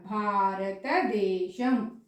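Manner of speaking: slow భారతదేశం with plain దే; careful teacher's భారతదేశం; correct pronunciation. Shown as a demonstration. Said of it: "దేశం అనట్లే ఇక్కడ".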